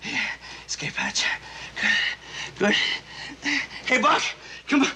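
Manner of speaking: ordinary talk, nothing marked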